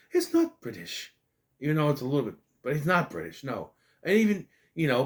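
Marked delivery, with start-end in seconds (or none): 0.1-1.1 Pooh voice. Retold or imitated. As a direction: put on a gentle bear voice for a moment. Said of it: "who sounds like this".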